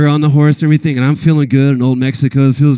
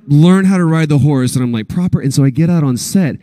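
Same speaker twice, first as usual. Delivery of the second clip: normal talking voice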